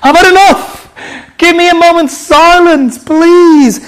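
high, loud telling-off; fed-up parent yelling; exasperated parent. Acted. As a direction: shout at noisy kids for silence. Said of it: "what you say to the kids sometimes".

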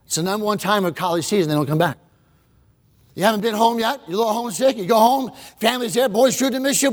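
ordinary talk

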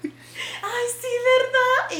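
high, airy mock mom reply; lightly voiced mom. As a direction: brush it off like an unbothered mom. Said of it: "mi mamá".

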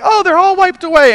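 high, loud mock-breezy relief; retold good news. Caller's bills cleared over the phone, retold high and excited.

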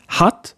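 hatt with a short, open front 'a'. Shown as a demonstration. Teacher contrasting the Swedish vowels, short A in hatt. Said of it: "a short one".

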